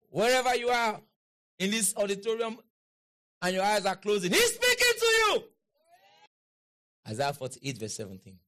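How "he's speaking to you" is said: high, loud burst from the pulpit; sudden preaching shout. Real, not acted. Preacher to those with closing eyes, a sudden high shout. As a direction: shout it high to wake the sleepers.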